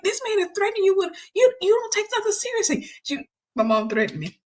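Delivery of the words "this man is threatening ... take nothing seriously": high-pitched mock scolding; mom going off. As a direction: imitate an exasperated mother, stumbling in indignation. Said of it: "went off on me".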